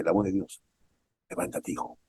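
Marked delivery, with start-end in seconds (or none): 1.3-2.0 breathy whisper of God's voice; whispered command. Acted. Preacher voices God whispering to the downcast listener. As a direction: whisper it like God speaking close. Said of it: "la voz de Dios que te dice".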